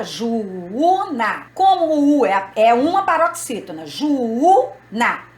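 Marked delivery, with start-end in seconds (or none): 0.0-1.5 "juúna" split as ju-Ú-na; separated syllables. Shown as a demonstration. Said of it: "a gente separa". 3.9-5.3 "juúna" stretched as ju-Ú-na; stress on second U. Shown as a demonstration. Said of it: "A sílaba forte é o U sozinho".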